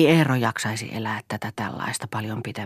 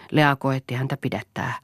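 ordinary delivery

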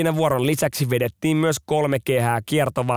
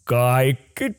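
ordering; 3 dB louder, much slower than usual. Retold or imitated. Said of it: "Luutnantti huutaa".

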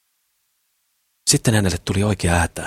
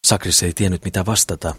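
ordinary delivery, nothing marked

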